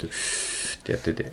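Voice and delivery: hissing breath. Shown as a demonstration. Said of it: "口をすぼめて".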